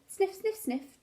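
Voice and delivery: plain reading voice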